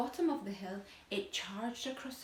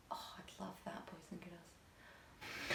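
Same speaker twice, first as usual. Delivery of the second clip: whispered aside; 4 semitones lower, 16 dB quieter, slower than usual. Real, not acted.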